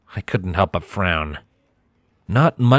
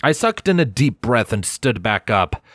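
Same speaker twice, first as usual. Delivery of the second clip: usual speaking voice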